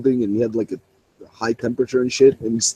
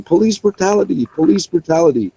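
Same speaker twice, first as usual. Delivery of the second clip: retold shout; 8 semitones higher, 4 dB louder than usual. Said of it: "this guy's shouting".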